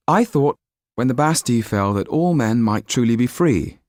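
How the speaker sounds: put-on young Jan. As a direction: speak up with youthful idealism. Narrator voices young Jan.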